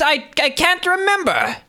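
high strained flustered voice; stuck high pitch. Acted. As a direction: squeeze it out high and tense. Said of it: "high, strained voice".

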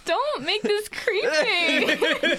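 loud, slow laughing shout; laughing protest. Real, not acted. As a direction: laugh while begging them stop.

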